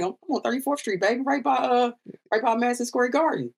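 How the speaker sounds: casual reply pitched well up; telling his friend where he's staying, high and easy; breezy high self-quote. Retold or imitated. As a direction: toss it off high and light.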